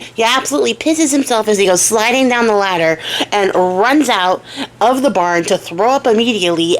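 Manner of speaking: normal talking voice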